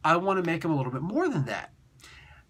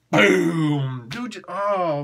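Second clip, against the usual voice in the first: ordinary talk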